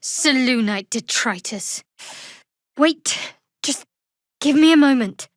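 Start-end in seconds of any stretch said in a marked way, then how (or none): 2.8-5.3 louder, higher, pushing through pain; strained in pain. Acted. Wounded fighter asking companions to wait, strained. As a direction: force the words out while hurt.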